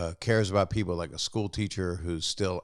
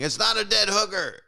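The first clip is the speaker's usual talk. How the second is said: mock yell of protest, much higher; imitated yelling; 12 semitones higher than usual. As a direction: yell an indignant denial in a raised pitch, imitating someone. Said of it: "David Koechner is yelling".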